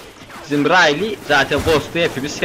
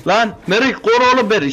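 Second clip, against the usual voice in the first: panicked yell; 10 semitones higher than usual. Real, not acted. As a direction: cry out as enemies close in from every side. Ambushed from all sides, panicking. (panik içinde yüksek sesle bağırma)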